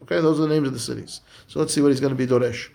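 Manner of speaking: ordinary delivery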